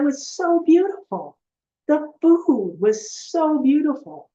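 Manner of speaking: high, slowing down in wonder; awestruck. Retold or imitated. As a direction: savour every word, marvelling. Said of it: "She said".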